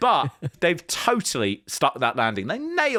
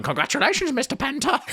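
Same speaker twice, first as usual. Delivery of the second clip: high-pitched mock congratulations; Danhausen; 11 semitones higher, faster than usual. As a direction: imitate gleeful, pitched-up congratulations. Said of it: "straight on his Twitter going".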